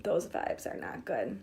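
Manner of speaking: breathy whisper, slow and soft. Shown as a demonstration. Coach whispers a warning about bad vibes. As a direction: hush it like a warning to yourself.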